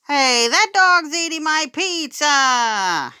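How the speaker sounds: put-on high, loud, slow girl's wail of outrage; girl crying out. A narrator's reading. A girl wails as the dog eats her pizza, high and loud. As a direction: complain like an upset little girl. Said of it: "a girl cried".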